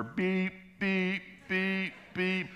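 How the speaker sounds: high, even car-alarm beeps; shrill-pitched repeated alarm beep. Retold or imitated. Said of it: "this car alarm goes off".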